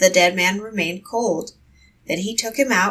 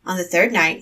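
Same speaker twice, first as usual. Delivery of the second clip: plain reading voice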